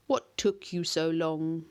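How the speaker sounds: lightly voiced monster, slow and weighty. A narrator's reading. The monster greets Connor in the garden, slow and weighty.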